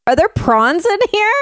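rising, alarmed question; a friend suddenly alarmed; alarmed surprise. Retold or imitated. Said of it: "he was like".

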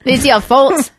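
hammed-up Australian blame; mock Australian accent. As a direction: put on a broad, bad Australian accent. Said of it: "But he's Australian".

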